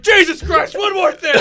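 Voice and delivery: high, loud outburst; mock exasperated dad. Joking about handling his kid falling, mock exasperated yell. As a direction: blow up like a fed-up parent, for laughs.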